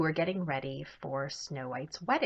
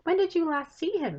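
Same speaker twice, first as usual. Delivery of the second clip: put-on eager girl; 12 semitones higher, 6 dB louder than usual. Kara asks eagerly.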